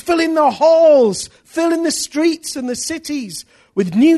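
high, loud prophetic proclamation; preaching at full force. Acted. Preacher to congregation, rising full-force proclamation. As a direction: proclaim the coming revival.

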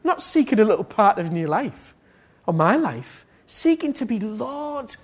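high, emphatic exhortation; impassioned preaching. Real, not acted. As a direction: urge the congregation at full force. Preaches at full force.

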